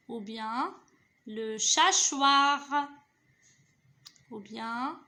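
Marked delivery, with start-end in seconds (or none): 1.3-2.6 "chachoir" loud and slow, both syllables drawn out; joining syllables. Shown as a demonstration. Teacher testing a possible word, "chachoir" slowly sounded out. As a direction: stretch "cha" and "choir" clearly. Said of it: "Je relis les syllabes".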